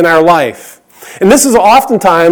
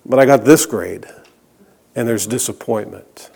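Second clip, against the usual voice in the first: much lower and much softer, sinking; dropping to a hush; 8 semitones lower, 8 dB quieter than usual. Acted. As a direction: let the refrain fall away to almost nothing. Sermon refrain, dropping away.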